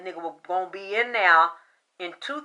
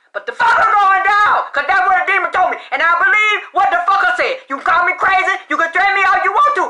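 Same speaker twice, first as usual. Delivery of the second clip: from steady talk up to loud defiant yelling; building shout; 5 semitones higher, 11 dB louder than usual. Real, not acted. Psychic defending her prediction to doubters, building to a shout. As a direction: climb into a furious shout.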